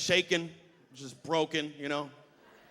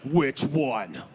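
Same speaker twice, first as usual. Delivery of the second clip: bouncer; 8 dB louder than usual. Retold or imitated. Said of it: "He's like".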